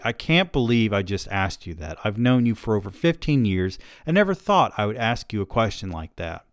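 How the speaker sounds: usual speaking voice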